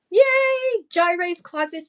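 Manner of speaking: high, loud happy yay for a buyer; excited cheer. Real, not acted.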